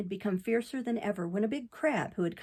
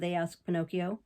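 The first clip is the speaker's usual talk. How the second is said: ordinary delivery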